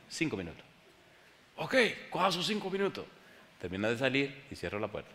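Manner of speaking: ordinary talk, nothing marked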